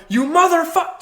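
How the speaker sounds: high, loud mock curse at the stage; mock outburst. Showrunner mimicking a heckler, loud mock outburst. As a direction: yell it like a heckler losing it. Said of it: "if you have the urge to go".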